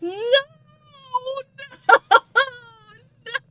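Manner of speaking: high, crying no; anguished cry. Real, not acted. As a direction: wail it out in real grief. Shocked by the story reveal.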